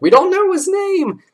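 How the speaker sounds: very high, loud, slow shriek; panicked shriek. Retold or imitated. Brain workers screaming in panic, high shrieking wail. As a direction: wail it like the sky is falling.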